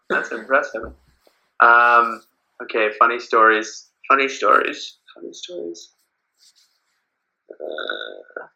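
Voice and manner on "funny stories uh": very soft whisper to oneself; whispered musing. Real, not acted. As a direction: murmur it under your breath while searching your memory. Thinking to himself, searching for a story.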